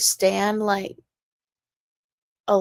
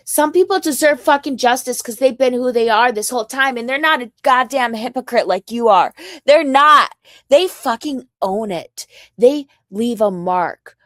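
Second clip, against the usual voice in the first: angry rant; 2 semitones higher, 7 dB louder than usual. Real, not acted. Escalating rant at caller.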